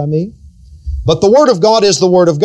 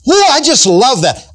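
gushing mock delight; 7 semitones higher than usual. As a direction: gush over it like a silly fan. Preacher mimics gushing people.

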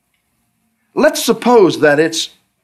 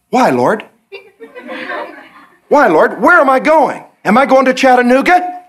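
pleading questions; 9 semitones higher than usual. Retold or imitated. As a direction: beg God for answers. Believer pleading, rising.

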